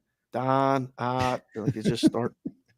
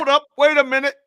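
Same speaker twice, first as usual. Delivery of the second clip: high loud wait a minute; mock alarm; 8 dB louder than usual. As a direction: yelp it in fake shock. Joking echo of disbelief.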